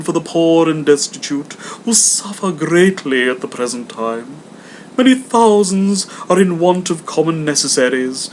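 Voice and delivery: hammed-up earnest collector; earnest gentleman. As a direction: plead for the poor politely. Gentleman pleads.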